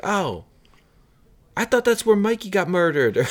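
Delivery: high, mock-surprised bar guy; surprised local. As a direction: imitate a local hearing he was wrong. First patron answers back.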